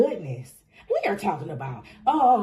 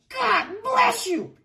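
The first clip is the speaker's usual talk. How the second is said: high, drawn-out blessing; overcome exclamation; 11 semitones higher, slower than usual. Real, not acted. Reader blessing the listener over good cards, overcome. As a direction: cry it out slowly and high. Overwhelmed by the card reading.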